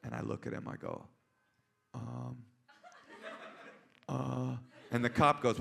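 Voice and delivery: ordinary talk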